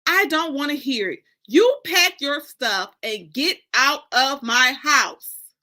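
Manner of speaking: mother ordering daughter out, loud and slow; angry mother. Acted. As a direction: lay down the law, slowly. Honey throwing daughter out.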